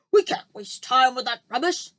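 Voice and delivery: dismissive general. A narrator's reading. General dismisses the BFG's dreams, loud and curt. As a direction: brush it off impatiently.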